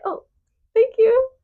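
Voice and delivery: high, loud retold startled thank-you. Recalling her reply to the phone going off, startled. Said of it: "I just remember being like".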